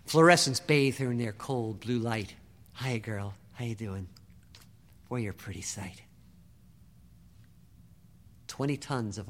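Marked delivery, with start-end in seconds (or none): 2.8-6.0 put-on soft, hushed hello to an old friend; tender greeting. Jake greeting his beloved old tank, softly tender. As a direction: greet her gently, softer.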